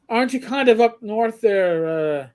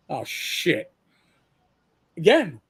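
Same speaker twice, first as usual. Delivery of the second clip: normal talking voice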